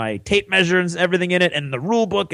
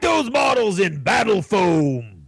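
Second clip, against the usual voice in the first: high barking drill sergeant shout; 7 semitones higher than usual. Acted. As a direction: yell at the recruit like a drill instructor.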